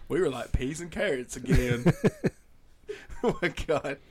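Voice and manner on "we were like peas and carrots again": Forrest Gump. Acted. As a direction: lean on the last word in a Southern accent. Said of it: "do Forrest Gump".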